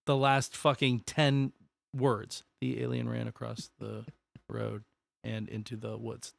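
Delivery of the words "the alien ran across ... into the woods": dropped to near whisper; quiet, trailing reading; hushed reading. Pretend. Quietly rereads the line.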